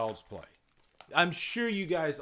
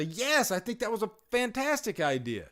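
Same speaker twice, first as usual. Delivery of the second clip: higher, enthusiastic mock agreement; imitating eager viewers; 6 semitones higher than usual. Streamer voicing viewers backing an idea, mock enthusiasm. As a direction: chime in as a keen fan. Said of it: "everybody can jump in there and say".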